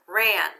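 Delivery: "Ran" as one isolated, loud syllable; syllable read-out. Shown as a demonstration. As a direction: sound out "Ran" firmly on its own. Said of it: "Read the first syllable".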